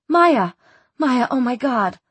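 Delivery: high, startled cry of a name; shocked recognition. Acted. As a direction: exclaim in shocked disbelief. Finds her missing friend captive.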